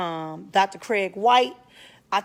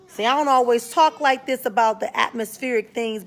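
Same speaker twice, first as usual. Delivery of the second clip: ordinary talk, nothing marked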